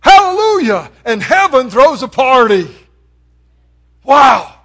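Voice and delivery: loud, high Hallelujah; joyful shout. Acted. Preacher voicing heaven's celebration, loud joyful shout. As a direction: shout it out for joy.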